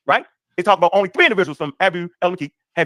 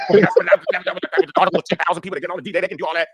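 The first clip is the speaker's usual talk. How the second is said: mocking imitation. Mocking people misreading the article.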